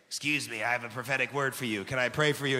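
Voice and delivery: low, monotone mock-solemn approach; weird prayer offerer. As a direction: drop low and play an awkward would-be prophet. Playing the weird prayer giver.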